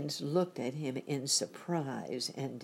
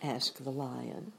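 usual speaking voice

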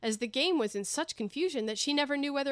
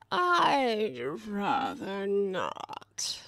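cat's whisper; much slower than usual. Acted. The cat refusing to kiss the King's hand, slow whisper.

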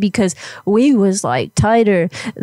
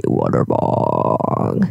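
very slow, sinking low drawl; exaggerated slow-down; 5 dB quieter, much slower than usual. Shown as a demonstration. Caller mockingly slows it down for Elizabeth, exaggerated slow low drawl. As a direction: stretch every word like a record slowing down. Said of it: "I could slow it down for you".

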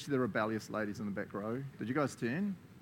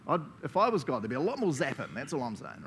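ordinary talk, nothing marked

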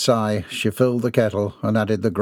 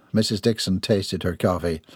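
ordinary delivery

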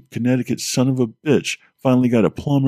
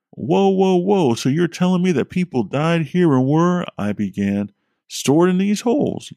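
shocked; 8 semitones higher than usual. Acted. Narrator shocked at bodies.

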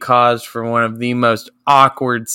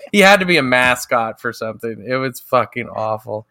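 usual speaking voice

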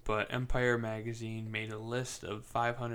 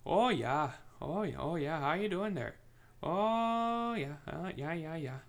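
mocking Minnesota accent, pitched higher, sing-song "Oh, yeah"; over-the-top Minnesota accent; 6 semitones higher than usual. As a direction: ham up a polite Minnesotan from the movie. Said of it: "the accent in the movie is over the top".

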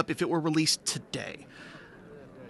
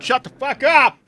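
yelling; 11 semitones higher, 9 dB louder than usual. Acted. Yells at noisy audience.

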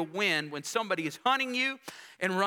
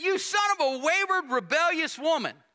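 much higher, louder furious shout; furious outburst; 9 semitones higher, 3 dB louder than usual. Acted. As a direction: hurl the insult at your son in rage. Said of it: "Then Saul's anger burst against Jonathan".

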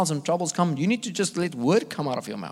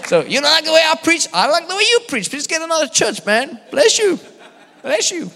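much higher, louder put-on preacher accent; David Hogan's accent; 13 semitones higher, 6 dB louder than usual. Preacher snapping back at a critic in a loud, high accent. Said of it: "but he has this accent".

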